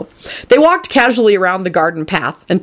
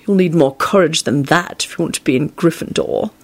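yawning Snape. A narrator's reading. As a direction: put the boy down wearily. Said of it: "yawned Snape".

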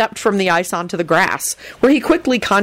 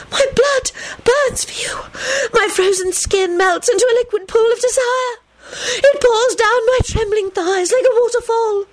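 wailing. Acted. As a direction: wail it out in rapture. Naiad voiced by narrator.

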